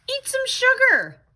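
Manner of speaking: high, eerie little ghost. Acted. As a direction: speak up high like a friendly ghost. Ghost's advice in story.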